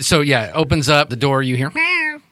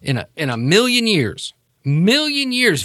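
flabbergasted disbelief; 9 semitones higher than usual. Acted. Maureen flabbergasted.

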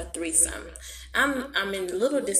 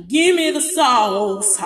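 loud slow cry; 5 semitones higher, 7 dB louder, slower than usual. Acted. Loud drawn out.

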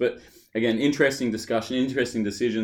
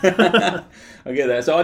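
slow, laughing, higher; 7 semitones higher, 7 dB louder, much slower than usual. Real, not acted. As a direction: laugh it out slowly. Laughing at partner's story.

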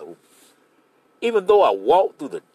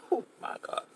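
usual speaking voice

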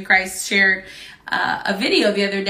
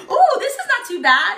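put-on high-pitched little boy reassuring himself; laughing, high mock relief; imitated relieved boy; 11 semitones higher, 3 dB louder than usual. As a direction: mimic a kid calming down mid-ride. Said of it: "then suddenly he starts screaming".

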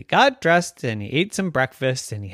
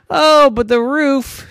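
worried; 10 semitones higher, 8 dB louder, slower than usual. Acted.

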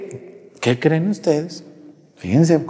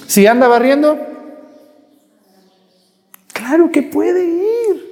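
higher, slow mock exclamation; sarcastic; 10 semitones higher than usual. Real, not acted. To the congregation about the sweeping woman, sarcastic. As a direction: drag out the obvious, mocking. Priest mocks the excuse.

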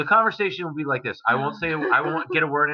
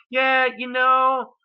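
high, slow, lilting imitation of her; mock chatty girlfriend; 11 semitones higher, slower than usual. Imitating his girlfriend chatting on the phone, high and drawn out. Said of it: "every time she called me".

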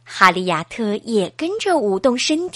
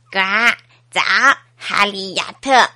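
a lower, croaky frog voice; 5 semitones lower than usual. Acted. 小青蛙低声呱呱地打招呼.

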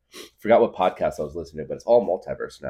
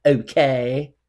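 higher, louder, slow sweet mom voice; mom's voice; 7 semitones higher, 6 dB louder, slower than usual. Retold or imitated. Mom asking her son to perform for guests, slow and sweet. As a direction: coax slowly like a doting mother. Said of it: "She was like".

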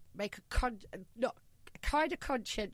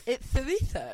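louder, higher "chorizo" with a th, through laughter; Spanish lisp, laughing; 6 semitones higher, 7 dB louder than usual. Retold or imitated. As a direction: ham up the Castilian th. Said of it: "do it with a th".

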